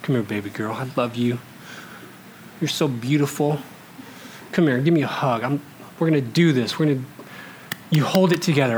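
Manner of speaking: low, soft coaxing of a little daughter; tender talking down. Retold or imitated. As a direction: gently soothe your upset child, acted. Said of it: "I can talk her down anytime".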